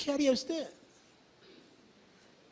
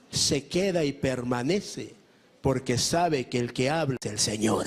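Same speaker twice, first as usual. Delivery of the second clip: ordinary talk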